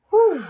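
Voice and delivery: high, loud mock relief sigh; hammed-up whew of relief. A narrator's reading. Teacher pretending relief to the class, hammed-up. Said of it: "pretended to wipe her brow".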